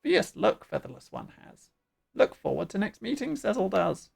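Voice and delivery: lilting bird-creature voice; squawky bird chief. Acted. Bird chief bidding the featherless one farewell, squawky. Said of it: "doing the bird voice".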